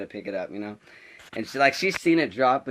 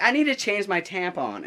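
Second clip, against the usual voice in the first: high-pitched imitated plea; insistent protest; 7 semitones higher than usual. Girl insisting to the teacher she must go, exasperated protest. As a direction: protest in a raised, exasperated mimicking voice.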